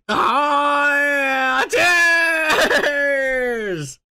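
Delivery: high, loud whooping cheer; celebratory shout. Acted. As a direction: yell it out like toasting the chat.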